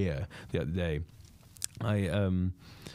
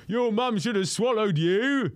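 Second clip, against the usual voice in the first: loud, slow heckle yelled from the crowd; loud heckler shout; mock heckle; 11 semitones higher, 4 dB louder, slower than usual. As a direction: bark it at the stage. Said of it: "he just goes".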